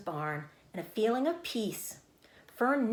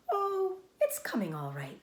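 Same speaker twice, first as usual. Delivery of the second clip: light; 8 semitones higher than usual. A narrator's reading. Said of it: "she said lightly".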